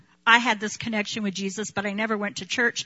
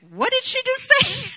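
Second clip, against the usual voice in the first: much higher, incredulous squeak; baffled friends; 3 dB louder than usual. Retold or imitated. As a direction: sound like you can't believe your ears. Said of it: "they'd be like".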